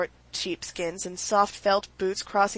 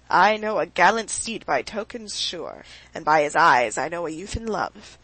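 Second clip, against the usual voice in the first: declaiming; 4 dB louder than usual. A narrator's reading. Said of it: "declaimed Stepan Arkadyevitch".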